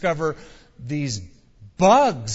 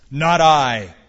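very loud, defiant refusal; firm declaration; 10 dB louder than usual. A narrator's reading. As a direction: declare it forcefully to the group. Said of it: "declared one".

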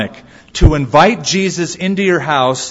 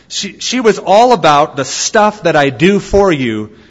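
ordinary delivery

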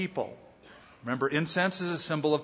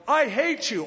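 high, mock angry shout; angry little boy yelling at grandfather; 10 semitones higher, 3 dB louder than usual. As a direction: hurl it at grandfather. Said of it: "he ran outside and yelled".

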